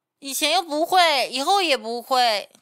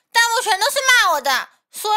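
outraged outburst; 8 semitones higher, 6 dB louder, faster than usual. Real, not acted. Outraged complaint about comments.

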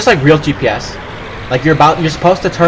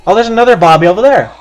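startled shout; 6 semitones higher, 7 dB louder, faster than usual. Real, not acted. Spotting zombies in the game.

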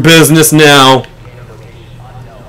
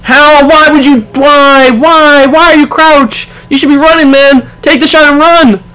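urgent, higher shouting at the screen; frantic, trying to warn him in time; yelling warning; 8 semitones higher than usual. Real, not acted.

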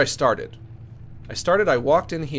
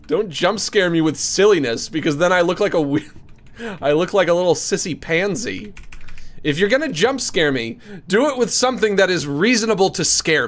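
mock scolding; 5 semitones higher, 6 dB louder than usual. Real, not acted. Scolding the game, laughing.

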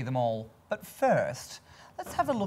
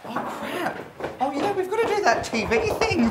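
flustered panic; 14 semitones higher, 6 dB louder than usual. Acted. Panicked about being late.

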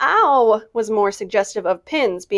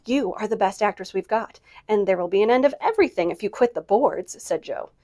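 ordinary delivery, nothing marked

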